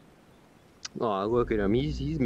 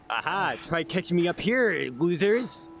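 mock taunt; 6 semitones higher than usual. Real, not acted. Taunting enemies from above.

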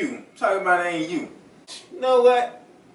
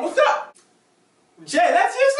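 surprised recognition; 11 semitones higher than usual. Acted. Recognising friend as burglar.

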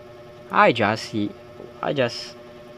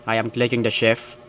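ordinary talk

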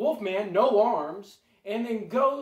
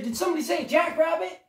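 high, loud, quick call; 8 semitones higher, 5 dB louder, faster than usual. Acted. Unseen rabbit calls out from the briar bush, high and loud. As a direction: butt in loudly with a quick question.

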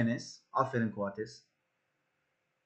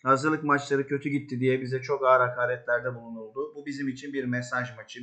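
normal talking voice

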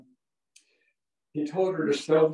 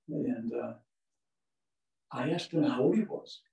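ordinary talk, nothing marked

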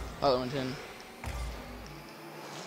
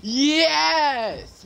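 higher and louder each yes; excited cheering; 5 semitones higher, 3 dB louder, much slower than usual. Real, not acted. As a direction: celebrate a win, building up. First win celebration.

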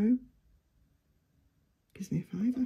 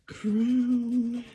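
ordinary delivery

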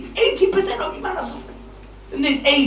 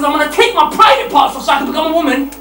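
mock shout; 7 semitones higher, 6 dB louder than usual. Mocking quote, shouted.

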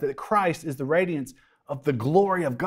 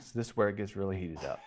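ordinary talk

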